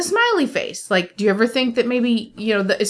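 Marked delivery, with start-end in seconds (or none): 0.0-0.9 higher-pitched, sweetly said emoticon; mock sweetness. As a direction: say it high and falsely sweet. Reading out a cheerful emoticon.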